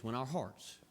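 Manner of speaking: normal talking voice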